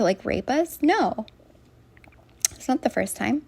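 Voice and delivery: ordinary delivery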